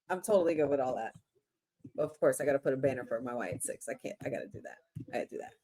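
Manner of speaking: soft, fast aside; quiet muttering. Real, not acted. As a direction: mutter to yourself quickly.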